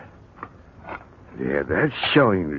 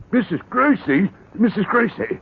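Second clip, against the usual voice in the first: calling out; 14 semitones higher, 4 dB louder than usual. Acted. Calling neighbour from the garden.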